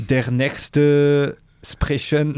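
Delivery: mock German phrase; German accent. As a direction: speak broken German, hesitant. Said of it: "en allemand, s'il te plaît?".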